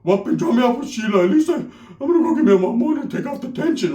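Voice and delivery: imitating a rattled cousin; freaked-out primo. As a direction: mildly panicky put-on primo voice. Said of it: "he was really, really, truly freaked out".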